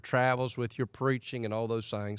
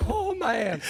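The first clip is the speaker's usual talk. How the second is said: high, loud laughing reaction; 12 semitones higher, 4 dB louder than usual. Real, not acted. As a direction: crack up while reacting. Listener cracks up at the story.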